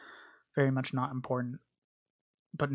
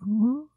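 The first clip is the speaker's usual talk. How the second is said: excited ooh; 10 semitones higher, 7 dB louder than usual. Acted. Mock zombie peeking.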